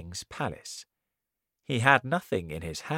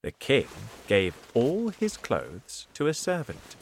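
ordinary delivery, nothing marked

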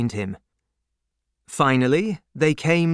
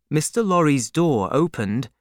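usual speaking voice

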